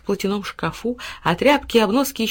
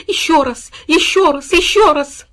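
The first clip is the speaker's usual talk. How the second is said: high, loud, rising insistence; excited dog; 8 semitones higher, 7 dB louder than usual. A narrator's reading. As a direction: beg exuberantly like an overjoyed dog. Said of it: "Пёс подпрыгнул и лизнул". (восторженные громкие выкрики пса)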